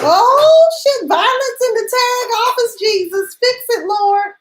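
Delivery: high-pitched, hammed-up shock; mock alarm. Onlooker reacting to violence in the tag office, mock alarm. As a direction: cry out to the Lord in comic panic.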